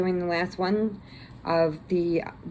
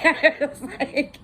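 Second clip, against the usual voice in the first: normal talking voice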